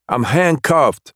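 high, slow disbelief; stating the obvious, amazed; incredulous protest. Acted. Suspect incredulous at order.

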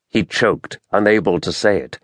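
ordinary delivery

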